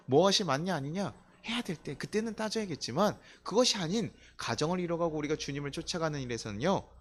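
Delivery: ordinary talk, nothing marked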